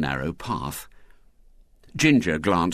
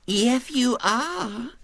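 put-on very high, slow, untroubled child; serene little girl; much slower than usual. A narrator's reading. Girl overruling William's refusal, serene. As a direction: contradict serenely in a small girl's voice. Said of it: "said Violet Elizabeth serenely".

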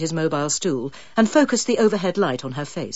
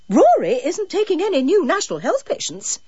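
curt; 12 semitones higher, 3 dB louder than usual. Acted. Barbara curtly shuts down request.

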